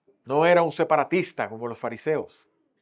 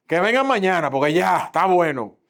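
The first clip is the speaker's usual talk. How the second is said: fed-up dismissal; 4 semitones higher, 6 dB louder than usual. Acted. Peter turns people away.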